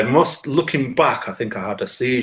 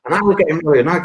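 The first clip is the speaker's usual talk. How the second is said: ordinary delivery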